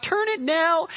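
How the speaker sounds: whiny imitated wife begging her husband; pleading wife. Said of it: "finally use, like, their whole full name".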